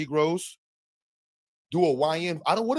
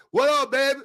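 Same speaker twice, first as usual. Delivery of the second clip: put-on Southern accent; 11 semitones higher, 5 dB louder than usual. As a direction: greet her like a Southern man. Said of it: "That's how y'all used to".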